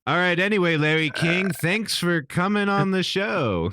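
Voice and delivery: a hint of Norm moving on; Norm Macdonald impression. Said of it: "And he's like".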